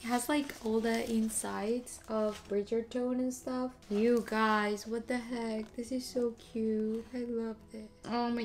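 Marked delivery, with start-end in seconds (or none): none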